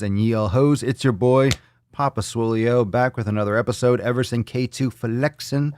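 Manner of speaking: ordinary talk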